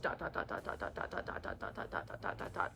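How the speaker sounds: toneless whispered dot, dot, dot. Pretend. Mimicking the blank Warner chapter in a whisper. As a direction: breathe the dots out like a blank page.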